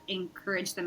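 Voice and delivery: ordinary talk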